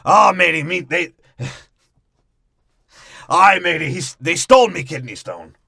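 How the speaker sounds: loud laughing mock pirate; hammed-up pirate voice. Podcaster mocking Barbossa to Karina, laughing pirate voice. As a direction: bark it like a cackling old pirate.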